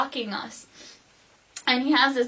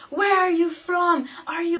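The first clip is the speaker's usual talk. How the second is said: mock yell, high, loud and drawn out; imitated yelling; 7 semitones higher, 4 dB louder than usual. Ex yelling at the other driver, imitated yell. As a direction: lightly imitate someone yelling a slow challenge. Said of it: "He starts yelling".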